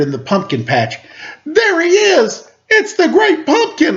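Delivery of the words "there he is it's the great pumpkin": excited shout. A narrator's reading. Linus spotting a figure in the pumpkin patch, high excited shout. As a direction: cry out in pure wonder. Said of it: "Linus shouted".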